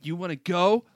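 loud, high, mock fight challenge. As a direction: yell it like picking a fight.

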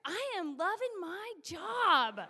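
slow, gloating joy; gleeful exulting. Retold or imitated. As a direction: savour each word with relish. Exulting at being part of story.

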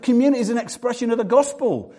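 normal talking voice

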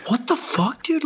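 high-pitched stunned what the fuck; shocked outburst. Retold or imitated. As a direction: imitate his disbelief, pitch jumping way up. Angry friend finds pigs in car.

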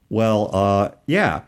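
normal talking voice

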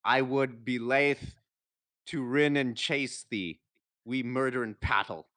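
put-on loud, high, stumbling read of old Scots verse; sounding out Scottish dialect poetry, loud and amused; reading Burns aloud. Friend reads Burns's poem aloud on a dare, loud and halting. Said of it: "try to read this part of his poem".

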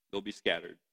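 ordinary talk, nothing marked

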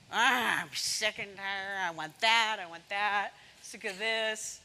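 lightly voiced grumbling Israelite, sick of it all; mock grumbling. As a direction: grumble like a complaining Israelite in the desert, only a touch put on. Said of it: "just grumbling in the wilderness".

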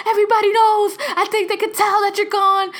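high, loud mock panic; paranoid sister. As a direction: imitate a sister sure the secret is out.

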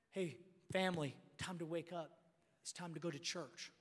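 low, soft wake-up call; acted tender, quiet fatherly invitation; gentle dad. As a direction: coax the family softly towards church. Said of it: "this is a little different conversations".

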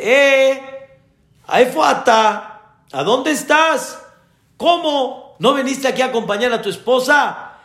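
high-pitched phone call to someone absent; acted calling out. Doctor phoning the absent father, calling out. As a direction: call out in reproach, pitched well up.

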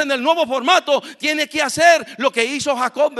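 high, emphatic preacher's delivery; full-force preaching. Real, not acted. Preacher telling brothers something happened to Jacob, fervent preaching. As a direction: drive it home to the congregation.

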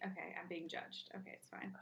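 whispered aside; whispering. Real, not acted. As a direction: whisper it to yourself. Feeling judged, aside.

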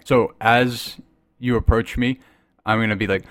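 usual speaking voice

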